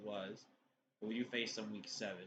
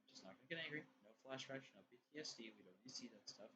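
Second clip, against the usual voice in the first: muttered aside; 2 semitones lower, 16 dB quieter, much faster than usual. Real, not acted.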